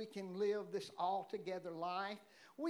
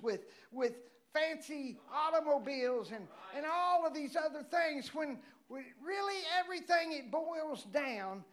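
preaching; 5 semitones higher, 4 dB louder than usual. Acted. Preaching climax, shouting.

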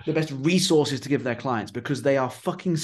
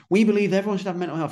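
higher, louder, rushed mission statement; imitated passion; 7 semitones higher, 5 dB louder, faster than usual. Couple telling the world their mission, imitated passion. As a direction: mimic an eager, hurried pitch. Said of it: "they're really enthusiastic, really passionate".

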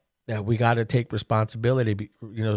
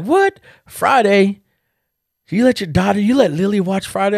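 high, loud, disbelieving outburst; mock-shocked sister, much higher and louder; 10 semitones higher, 6 dB louder than usual. Sister shocked that daughter watched Friday, loud high disbelief.

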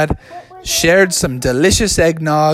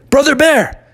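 short, higher-pitched call; calling out to wake; 8 semitones higher, 3 dB louder than usual. Acted. Friends waking a hibernating bear, short high call. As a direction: call the name sharply to rouse a sleeper. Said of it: "They said".

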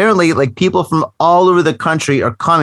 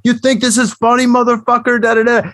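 higher mock threat through a mouthful of food; mouth full; 5 semitones higher than usual. Homeless man threatens the narrator, mouth full of sandwich. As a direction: talk out of half your mouth, the other half stuffed. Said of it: "talking with half his mouth with the other half full".

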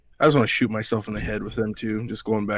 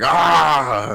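loud, higher "ah" mimicking a dog; imitated dog outburst; 5 semitones higher, 6 dB louder than usual. Podcaster showing Buckeye's little outbursts, imitated outburst. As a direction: blurt a sudden mock dog cry.

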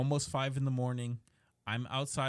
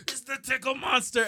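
playful monster voice; 10 semitones higher, 6 dB louder than usual. Retold or imitated. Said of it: "And I'm like".

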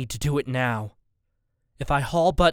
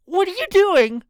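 high, louder terrified shriek; panicked screech; 7 dB louder than usual. A narrator's reading. Missus Borden shrieks at the armed man. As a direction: shriek it at the gunman.

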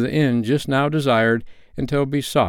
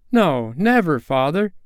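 high, swooping assurance; children's cry; 7 semitones higher than usual. A narrator's reading. Children reassure their grieving father, crying out high. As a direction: cry out as earnest children, pitch up. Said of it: "they all cried again".

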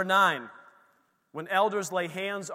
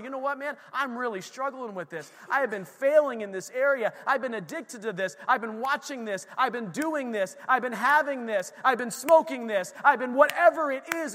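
high, driving list, building louder; urgent confession; 8 semitones higher, 5 dB louder than usual. Acted. Believer admitting struggles to a friend, urgent high-pitched confession. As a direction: pour out admissions in an insistent, rising torrent.

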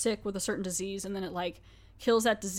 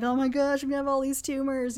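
higher-pitched imitated panic; lightly hammed-up dread, pitched up; mock freak-out; 4 semitones higher than usual. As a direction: act out a mild panic, voice lifted. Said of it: "so he's freaking out".

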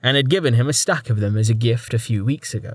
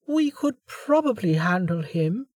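high, soft and cocky; a confident little girl; young girl voice; 8 semitones higher, 4 dB quieter than usual. A narrator's reading. Maharia, young voice.

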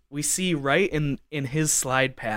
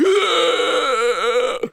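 loud, high drawn-out gasp; gasping for breath; 7 dB louder than usual. Retold or imitated. Imitating his fallen friend coming to, gasping. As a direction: suck in air as if winded. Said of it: "trying to catch his breath".

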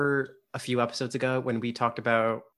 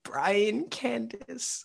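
very slow, high-pitched drawn-out name; incredulous; 12 semitones higher, much slower than usual. Real, not acted. Host hearing the fan's silly made-up name, incredulous. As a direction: stretch each name up high in disbelief.

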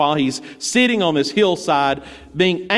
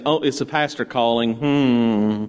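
reluctant congregant; 6 semitones lower than usual. Retold or imitated. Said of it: "some of you are going to be saying".

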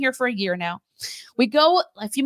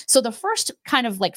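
usual speaking voice

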